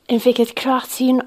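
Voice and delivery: usual speaking voice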